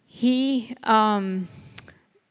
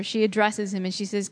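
ordinary talk

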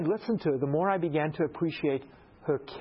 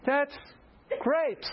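forced that's great; 12 semitones higher than usual. Retold or imitated. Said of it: "I'm like".

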